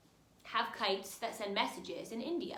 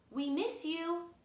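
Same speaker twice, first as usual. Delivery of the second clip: lightly higher, tender-sounding kite voice; kites drifting; 7 semitones higher than usual. A narrator's reading. Kites calling to ones long gone, lightly tender. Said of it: "they drift".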